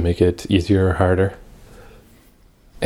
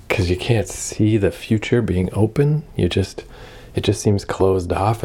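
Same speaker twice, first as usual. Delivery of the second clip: usual speaking voice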